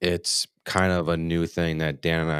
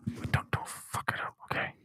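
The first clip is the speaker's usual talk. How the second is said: whispering; 12 dB quieter than usual. Real, not acted. Aside to co-host before show.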